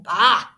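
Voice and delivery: loud scornful "Bah!"; grumpy bah. Acted. Scrooge with no better answer, loud bah.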